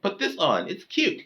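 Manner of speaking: high, squeaky mock-girly voice with a giggle; imitated girlfriend. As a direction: imitate her teasing in falsetto. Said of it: "she'd be like".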